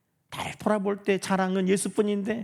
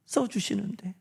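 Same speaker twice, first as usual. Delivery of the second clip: whisper; 9 dB quieter than usual. Real, not acted. Pastor whispering his confession to the congregation. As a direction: drop into a hushed whisper.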